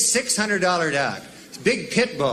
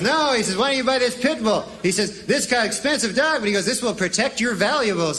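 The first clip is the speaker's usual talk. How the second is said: salesman; 6 semitones higher than usual. Retold or imitated. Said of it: "this guy goes".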